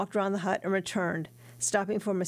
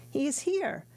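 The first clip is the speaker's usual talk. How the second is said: joyful; 8 semitones higher than usual. Acted. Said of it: "reddening with joy and leaping up".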